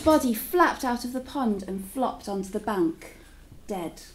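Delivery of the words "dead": ordinary delivery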